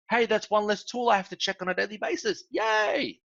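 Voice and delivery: high-pitched happy user, ending on a cheer; mock delight. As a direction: act pleasantly thrilled, topping it with a high "Yay!". Acted happy user welcoming a feature.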